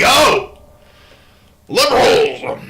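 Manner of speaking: high, loud mocking shriek; hammed-up gasp at the liberals; mock outrage. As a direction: squeal it like a caricature of shock. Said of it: "And I'm like".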